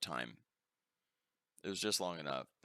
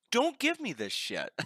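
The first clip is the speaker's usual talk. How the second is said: much higher, louder, slower disbelief; high incredulous protest; 6 dB louder, slower than usual. Retold or imitated. Refusing an expensive gift, high loud incredulous protest. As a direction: shriek it out in disbelief.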